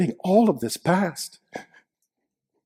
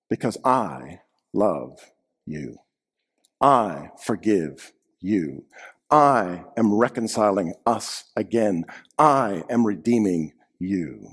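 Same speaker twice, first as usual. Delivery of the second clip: slow, soft and lower, full of love; tender; 6 semitones lower, 3 dB quieter, slower than usual. Acted. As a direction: speak it gently like a loving promise. Jesus voiced, tender promises.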